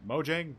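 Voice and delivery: Mojang said high and loud as "mo-jang?" with a rise; attempt at Mojang. Shown as a demonstration. Streamer trying out the name Mojang, unsure. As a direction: guess at Mojang like a question. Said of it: "This is gonna be tough".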